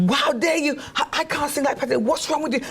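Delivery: 3 horrified she must sing; 1 panicked protest. Retold or imitated. 4 protesting to husband she can't sing like [name], panicked.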